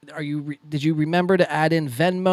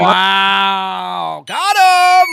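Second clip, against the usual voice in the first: triumphant shout; 3 semitones higher, 9 dB louder, much slower than usual. Real, not acted. Cheering a rival's comeback.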